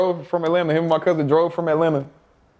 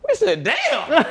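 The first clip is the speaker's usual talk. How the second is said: high, laughing disbelief; squeaky-high amused reaction breaking into laughter; much slower than usual. Real, not acted. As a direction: crack up while telling it. Laughing at the coach's insult.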